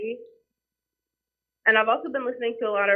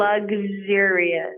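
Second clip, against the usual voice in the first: really slowly; much slower than usual. Real, not acted. Guest repeating a song title for the host, really slowly. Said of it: "could you say that really slowly".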